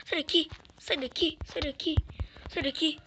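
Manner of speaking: high urgent chant; frantic yelling. Real, not acted. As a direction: keep shouting it, frantic. Yells again during a fight.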